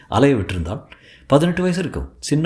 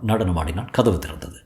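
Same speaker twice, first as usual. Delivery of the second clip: ordinary delivery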